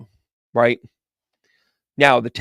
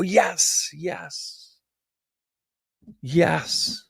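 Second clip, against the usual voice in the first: soft, high drawn-out yeses; awed yes; 4 semitones higher, 7 dB quieter, much slower than usual. Retold or imitated. Psychic reacting to the Snoopy punchline, soft and awed. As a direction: breathe it out in awe. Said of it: "And I go".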